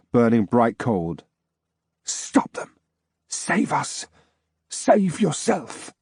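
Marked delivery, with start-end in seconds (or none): 2.0-5.9 hoarse ghostly whisper rising into a slow plea. Acted. Wolf-eyed ghost begging the narrator, whispered and urgent. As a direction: breathe the words like a fading spirit.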